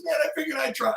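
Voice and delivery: high-pitched, give-up quote; lightly acted, voice way up; sheepish driver admission. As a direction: imitate a caught bluffer owning up. Driver admitting it, laughing.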